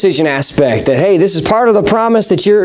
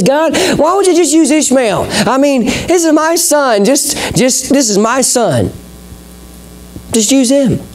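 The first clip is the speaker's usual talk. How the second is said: higher, earnest plea to God; Abraham pleading with God, voice pitched up; 7 semitones higher than usual. Acted. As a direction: beg God to use his son.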